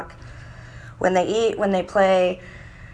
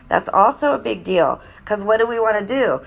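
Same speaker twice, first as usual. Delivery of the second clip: ordinary delivery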